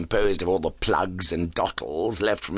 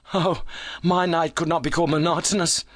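higher, animated character voice; animated visitor; 10 semitones higher than usual. A narrator's reading. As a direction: play a keyed-up visitor. Excited visitor in acted story dialogue.

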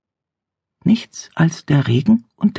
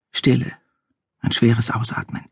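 normal talking voice

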